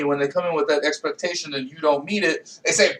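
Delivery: usual speaking voice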